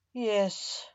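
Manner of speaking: plain reading voice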